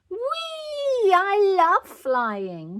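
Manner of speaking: high, loud, stretched-out whee; whooping little girl; gleeful shout. A narrator's reading. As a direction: shout with flying glee. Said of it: "shouted Peppa".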